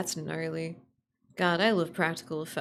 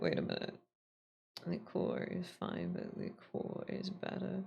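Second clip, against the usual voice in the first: whisper; 10 dB quieter than usual. Real, not acted. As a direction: breathe it out with no voice. Whispered aside about liqueur.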